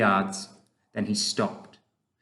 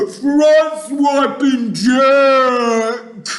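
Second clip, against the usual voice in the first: high, slow, full-volume wailing; drawn-out panicked giant howls; terrified bellow; 13 semitones higher, 12 dB louder, much slower than usual. A narrator's reading. Giant dreaming Jack attacks him, bellowing in terror. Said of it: "bellowed the flesh lump eater".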